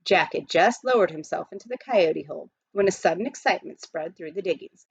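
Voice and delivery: usual speaking voice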